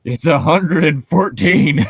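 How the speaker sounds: slow, loud comeback to weather chat; flat retort. Acted. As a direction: shoot down the small talk, slowly and loud. Deadpan reply to small talk.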